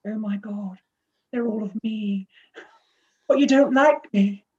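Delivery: a hint of a hurt, whiny Keira near the end; Keira Knightley. Retelling the Love Actually video scene, playfully mock hurt. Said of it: "And she's, like".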